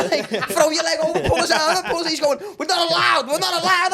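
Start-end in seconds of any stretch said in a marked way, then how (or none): 0.3-2.1 louder, higher urgent instructions; coaching shout. Retold or imitated. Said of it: "we were trying to coach him". 2.6-3.9 higher, louder officer's protest; a cop pleading he can't; mock panicked protest. Officer protests while pinned down.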